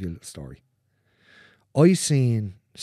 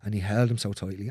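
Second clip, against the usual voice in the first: ordinary talk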